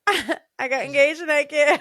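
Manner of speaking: loud, high-pitched excited shout; hammed-up squeal of big news, breaking into laughter; giddy announcement. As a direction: re-enact a gleeful boast to strangers. Acting out announcing engagement to teenagers.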